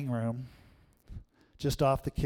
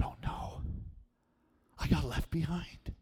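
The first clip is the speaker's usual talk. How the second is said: whispered dread; 2 semitones higher, 7 dB quieter than usual. Retold or imitated. Boy hangs up the phone and whispers in dread. Boy alone after no answer.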